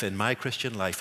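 ordinary talk

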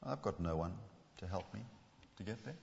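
very soft, fading, defeated; a hint of giving up, almost inaudible; resigned. Shown as a demonstration. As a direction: let it sink away in resignation. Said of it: "Was he resigned?".

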